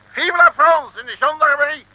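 high, loud rallying cry; patriotic cry. Acted. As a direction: shout it out like a toast to France. Driver cries a patriotic farewell.

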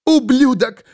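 high-pitched furious cry; shrieking outrage; screamed insult. A narrator's reading. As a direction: hurl it in a high, strained yell. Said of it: "закричал рот Кары Свол".

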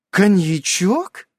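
slow, high, loud exclamation; exclaiming wife. A narrator's reading. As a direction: spot the bottle with delight. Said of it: "восклицает она".

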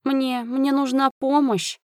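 high, slow, faltering whimper; pitiful drawn-out plea; pleading moan. A narrator's reading. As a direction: moan it weakly, begging for help. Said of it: "простонал Фрэнсис".

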